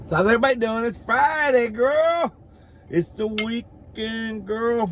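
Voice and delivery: high, sing-song party greeting; excited Friday cheer. Real, not acted. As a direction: ring in the weekend, high and stretched out.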